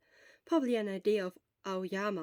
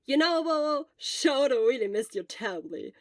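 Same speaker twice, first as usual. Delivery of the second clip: Hisashi's voice; 4 semitones higher, 8 dB louder than usual. Acted. Hisashi teasing Shota.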